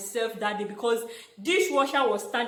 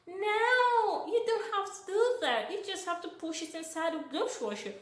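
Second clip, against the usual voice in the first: put-on high, light American accent for the host woman; American accent imitation; 9 semitones higher, 3 dB quieter than usual. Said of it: "what she was speaking in her accent".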